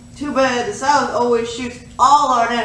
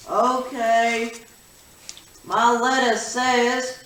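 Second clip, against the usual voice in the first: ordinary delivery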